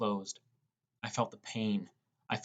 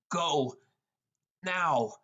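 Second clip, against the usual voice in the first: urgent command; 5 semitones higher than usual. Acted. Pushing Kira to escape.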